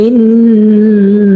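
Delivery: ইন্না with a long held nasal on the doubled n; ghunnah demo. Shown as a demonstration. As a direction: stretch the nn of ইন্না as a nasal hum. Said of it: "যেমন".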